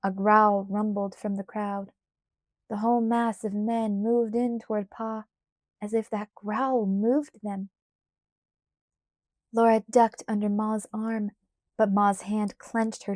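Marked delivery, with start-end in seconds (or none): none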